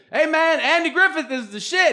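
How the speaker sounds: high, loud mock hype; hyped greeting. Audience member hailing the comedian, high and hyped. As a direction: yell it like a giddy fan. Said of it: "just go".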